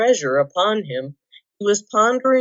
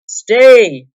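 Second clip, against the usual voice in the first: loud, sharp command; commanding call; 5 semitones higher, 11 dB louder than usual. A narrator's reading. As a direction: stop him dead. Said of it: "said a voice".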